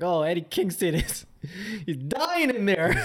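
hammed-up announcer alarm breaking into a laugh; mock commentator. Commentators reacting to Eddie Kingston down, mock-dramatic and laughing. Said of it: "the commentary is like".

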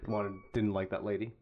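usual speaking voice

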